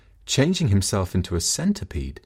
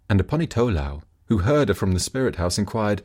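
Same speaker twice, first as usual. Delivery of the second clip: ordinary delivery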